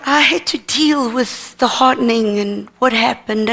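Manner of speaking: ordinary talk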